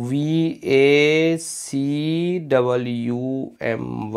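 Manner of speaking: letters stretched out one at a time; slow spelling dictation. Shown as a demonstration. Teacher stressing the double u in vacuum, slow dictation. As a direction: spell it slowly, leaning on the double u. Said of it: "ध्यान रखें double U आता है".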